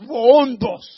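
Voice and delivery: βοόντος called out loud like a voice in the desert; a mock desert-prophet call; crying out. Shown as a demonstration. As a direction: boom out βοόντος. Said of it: "φωνή βοόντος".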